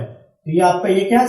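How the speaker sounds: ordinary delivery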